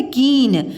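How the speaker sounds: कीन with a drawn-out long ee; long-vowel mispronunciation. Shown as a demonstration. Teacher shows pupils the wrong word, long ee in कीन. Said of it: "तो ये गलत हो जाएगा".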